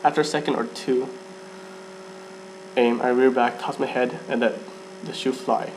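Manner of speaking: ordinary delivery, nothing marked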